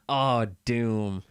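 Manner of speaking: ordinary delivery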